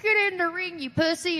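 high-pitched jeering taunt; mock heckling. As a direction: imitate a crowd egging someone on. Crowd heckling her at ringside.

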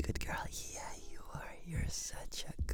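soft affectionate whisper. Acted. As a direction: whisper it close and tender. Whispered praise.

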